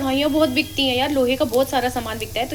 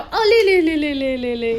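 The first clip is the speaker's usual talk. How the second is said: high burst falling away in pitch; 4 semitones higher, slower than usual. Real, not acted. Mother teasing her little girl, playful cooing.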